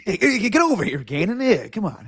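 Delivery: high-pitched mock coach beckoning his old player; imitating coach. Host mimics coach calling Gannon over, playful high-pitched imitation. Echoing coach's greeting from clip.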